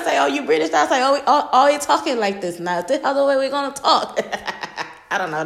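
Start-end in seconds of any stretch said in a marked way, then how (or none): 0.2-4.2 lightly teasing, half-laughing questions; teasing mock disbelief. Mother teasing son about his British talk, amused. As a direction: rib him like a mom who caught him. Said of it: "that was my best impression".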